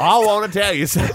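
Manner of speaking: high, loud, rapid celebrity impression; Joan Rivers. Friend joining in the impression, fast high Joan Rivers voice. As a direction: mimic a comedian, high and pushy. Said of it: "Hi, I'm Joan Rivers".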